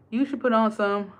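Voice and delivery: usual speaking voice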